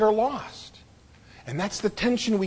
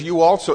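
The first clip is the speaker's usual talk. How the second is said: "you also" pressed out louder; emphatic you also; 6 dB louder than usual. Shown as a demonstration. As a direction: lean hard on "you also". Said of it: "is an emphatic".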